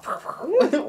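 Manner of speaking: high hollered cowboy yeehaw. Pretend. Cohost greets listeners with a high cowboy whoop. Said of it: "I always did twang".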